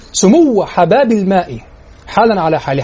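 إلقاء بطيء؛ إنشاد بطيء متأنٍّ؛ قلها ببطء لتتذوق الكلمات؛ إنشاد بطيء متأمل. Shown as a demonstration. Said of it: "يعيد إنشاد الشطر ببطء".

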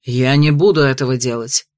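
low, curt refusal; sharp. Acted. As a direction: snap it back. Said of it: "резко ответил он".

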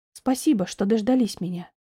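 ordinary delivery, nothing marked

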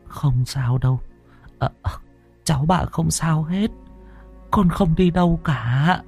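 holding back tears. Acted. Grandmother comforting her sick grandson, holding back tears. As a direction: comfort the boy while fighting tears. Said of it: "nén lại cơn xúc động".

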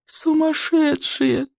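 high, slow despairing groan. A narrator's reading. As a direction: wail it in despair. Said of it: "простонал Рыбьенок".

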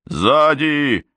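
high, urgent cry of warning; barked warning. A narrator's reading. Man warns ally of attack from behind, barked warning. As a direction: call out a sudden warning, pitch jumping up. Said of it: "рявкнул Нокс".

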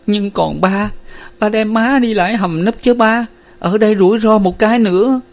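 high, tearful pleading; starting to cry. A narrator's reading. Daughter urges father into the shelter, tearful pleading. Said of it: "Nguyệt bắt đầu khóc mếu".

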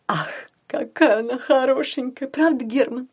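usual speaking voice

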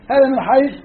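ordinary talk

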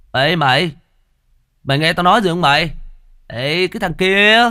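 put-on slurred drunken shout; drunk yelling. A narrator's reading. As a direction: yell out loud, slurring drunk. Drunk yells at shadow.

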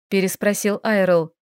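plain reading voice

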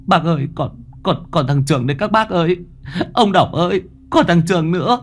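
put-on stammering boy. Boy stammering to the men about the other friend. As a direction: stutter out the news, frightened. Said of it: "Tôi lắp bắp".

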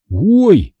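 a sharp high yelp; startled cry. A narrator's reading. Conor startled by thunder, short high yelp. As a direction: jump at the thunder. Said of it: "невольно вскрикнул я".

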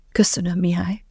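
breathy voiceless thanks; whisper. A narrator's reading. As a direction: hush it out, just breath. Thanking the angel quietly on walk.

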